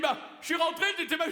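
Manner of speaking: high mock reply. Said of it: "Il me dit".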